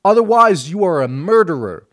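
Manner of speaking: high, loud ranting protester; mock street shouting. Religious freaks shouting at a dentist patient, high and loud. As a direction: shout it like a street zealot at a passer-by. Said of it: "they're all shouting".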